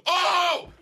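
high, loud yelp of a startled intruder; startled cry. Retold or imitated. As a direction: act out being caught off guard. Startled intruder cries out, retold.

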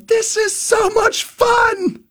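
high, slow, drawn-out whoop of fun; a novice skier loving the ride for a moment; gleeful cry. Retold or imitated. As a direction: stretch out the joy. Joy before losing control.

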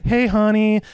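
high, sugary mock greeting to a wife; sweet-talking husband. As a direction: imitate a henpecked man answering sweetly. Boss sweet-talking wife on phone.

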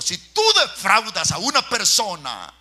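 loud, high warning; preaching shout. Acted. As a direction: hammer the warning home. (advertencia gritada)

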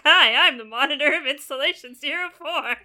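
hammed-up introduction, starting loud and high, then easing; Guilty Spark. Robot monitor introducing itself to the player, mock friendly greeting. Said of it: "Guilty Spark's like".

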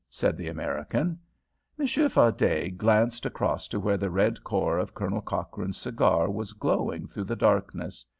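plain reading voice

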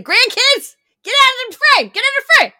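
put-on high, loud grandma yelling at kids; retold scream. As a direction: shriek at the grandkids to clear the shot. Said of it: "She's, like, screaming".

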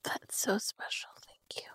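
barely breathed thanks, choked up; tearful whisper. Real, not acted. Whispering thanks to a friend after her tribute. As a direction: whisper it through tears, overwhelmed.

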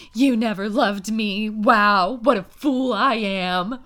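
slow, overwrought wounded voice; hammed-up heartbroken sigh, drawn out; mock dramatic lament. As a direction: play the tragic heroine with a sneer. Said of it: "And she's like".

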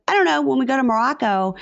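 lightly mocking a careless mother; clueless shrug. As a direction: toss it off like you've never thought about it. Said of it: "And she was like".

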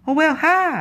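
very high squeaky mimic of a talking cat; cat saying hi. Host imitates the famous talking cat for her co-host, very high squeak. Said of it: "the cat that says".

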